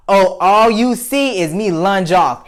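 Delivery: normal talking voice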